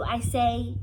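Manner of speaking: ordinary delivery, nothing marked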